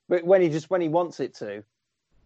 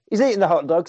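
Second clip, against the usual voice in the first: usual speaking voice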